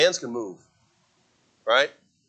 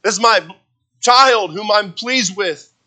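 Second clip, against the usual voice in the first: lightly acted proud father, raised pitch and volume; God as proud father; 7 semitones higher, 6 dB louder than usual. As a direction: show off your child, loud and high. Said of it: "he sees all, and he says".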